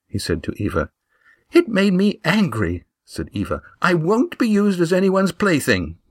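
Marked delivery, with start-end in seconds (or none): 1.5-2.8 put-on high, earnest girl's voice; young Eva. A narrator's reading. Eva warning the friends, high worried voice. 3.8-6.0 put-on high, earnest girl's voice; young Eva. A narrator's reading. Eva warning the friends, high worried voice.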